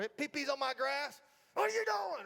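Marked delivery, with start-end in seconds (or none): 1.5-2.3 sharp high scold at a dog. Retold or imitated. Owner scolding the dog for peeing, sharp high scold. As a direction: bark it at the dog like a caught mess.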